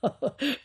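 a quick relieved chuckle; short laugh. A narrator's reading. Priest chuckling at the tyrant's death, relieved laugh. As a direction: chuckle before speaking.

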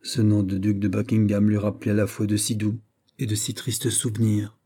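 ordinary delivery

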